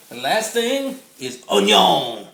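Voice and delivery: playful slow Cajun twang; Cajun accent. Retold or imitated. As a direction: lay on a homey Cajun drawl, slowly. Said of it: "My little Cajun, uh, accent there".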